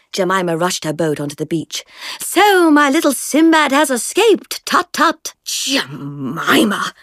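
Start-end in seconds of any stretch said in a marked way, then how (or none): 2.2-5.3 put-on teasing upper-class girl. A narrator's reading. Jemima teases Francis. 5.4-6.9 furious. A narrator's reading. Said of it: "Francis blazed".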